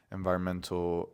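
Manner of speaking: ordinary talk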